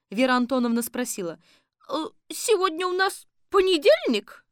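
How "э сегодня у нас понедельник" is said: high, loud questioning grandma; high cheerful granny. A narrator's reading. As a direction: ask brightly like a granny. Granny asks.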